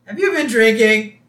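high, loud mock accusing question; Marge. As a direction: question him like a suspicious cartoon wife. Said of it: "She's like".

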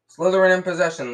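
loud, high match commentary; commentator. A narrator's reading. Lee Jordan commentating to the crowd, loud announcer call.

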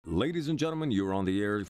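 normal talking voice

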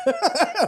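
high, loud burst of laughter; real laugh. Real, not acted. Amused by the quoted scene.

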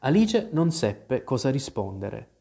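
ordinary delivery, nothing marked

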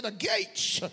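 loud, stretched-out preacher shout; shouted declaration. Shown as a demonstration. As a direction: shout it so they can hear. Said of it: "I want you to shout it".

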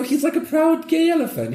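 high, proud camp voice. Retold or imitated. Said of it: "retelling punchline".

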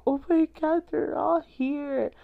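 very high, excited imitation of an awestruck viewer; high-pitched retold amazement; amazed fan gasp. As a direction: squeal it, stretching the last words. Acting out amazed audience at portals.

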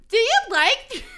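high, very loud mock squeal; exaggerated excited question; hammed-up shouted question. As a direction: blurt it out way too loudly. Acting teasing friend's question.

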